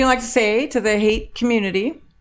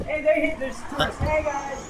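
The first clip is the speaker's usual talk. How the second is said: calling out greetings; 8 semitones higher, 5 dB quieter than usual. Real, not acted. Calling out of window to tourists.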